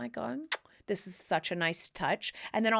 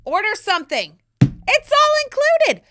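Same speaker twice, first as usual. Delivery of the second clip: higher, louder, everything's paid for; carefree mom shout; 9 semitones higher, 10 dB louder than usual. Pretend. Mom to hungry kids at the resort, a loud carefree shout. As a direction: boom it out like a jackpot winner.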